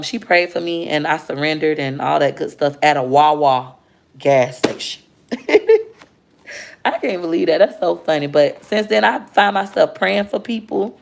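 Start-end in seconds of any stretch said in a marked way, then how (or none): 5.3-5.8 high laugh; sudden high laugh; burst of laughter. Real, not acted. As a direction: laugh out loud.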